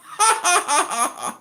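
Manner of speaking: high, staccato gloating laugh; gloating cackle. Pretend. Laughing at the losers who kept debating, gloating cackle. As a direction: cackle in triumph at the dupes.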